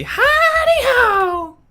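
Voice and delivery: very high, slow squeaky greeting; Mister Hanky. Retold or imitated. Asked by chat, streamer chirps Mister Hanky very high. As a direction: chirp it like a cheerful cartoon. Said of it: "Do Mister Hanky".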